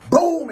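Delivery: sudden high, loud "boom"; imitated impact. Storyteller repeats the vase hit, loud high boom. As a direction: punch out the crash as a word.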